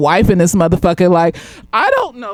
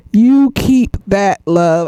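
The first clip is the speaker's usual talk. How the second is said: slow firm dismissal; 6 dB louder, much slower than usual. Real, not acted. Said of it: "setting a boundary".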